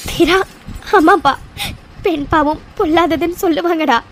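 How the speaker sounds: ordinary talk, nothing marked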